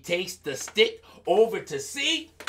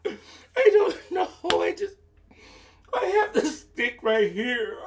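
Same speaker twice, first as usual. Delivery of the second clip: higher, louder with a laugh; sly Anansi; 11 semitones higher, 3 dB louder than usual. Acted. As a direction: slyly chuckle through it. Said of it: "Anansi said".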